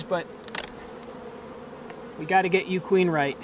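usual speaking voice

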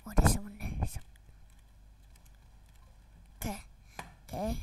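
hushed whisper to stay hidden. Real, not acted. Gamer spotting an enemy, whispering. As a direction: breathe it so the enemy can't hear.

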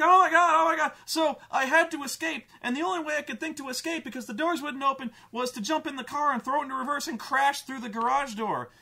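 put-on high, loud, fast frantic teen; breathless panic, words tumbling out; panicked girl. Girl running screaming to her dad, high frantic panic. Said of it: "She's like".

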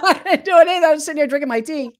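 fast, high, through laughter; laughing denial. Real, not acted. Denying the blame to her friend, fast and laughing. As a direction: protest innocence while laughing.

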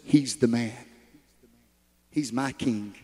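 low, grave divine declaration; the Lord speaking. Acted. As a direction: drop deep for the Lord choosing his king. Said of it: "and the Lord says".

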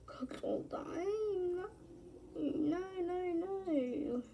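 high, slow moaning lament; drawn-out whine. Real, not acted. Bemoaning things going badly in the game, high drawn-out whine. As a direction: whine it out on long high vowels.

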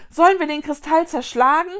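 loud, high urgent question; calling out. A narrator's reading. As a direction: throw the question out at full voice. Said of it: "rief Vicky".

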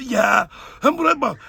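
ordinary talk, nothing marked

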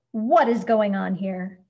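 loud, indignant demand; a boss roaring at a commotion; furious shout. A narrator's reading. Mister Grumps to the crowd in the store, furious shout. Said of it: "charged with fury, shout".

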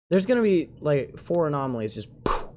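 ordinary talk, nothing marked

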